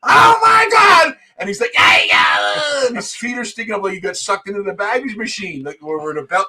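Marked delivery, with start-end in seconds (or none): 0.0-1.1 very high, loud, drawn-out mock scream; acted scream. Retelling panic at the baggage belt, high acted scream. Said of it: "screaming at people like". 1.7-2.9 high, loud mock yell; imitated scream. Imitating Frenchie stuck in the baggage machine, high mock scream. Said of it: "he was going around screaming at people".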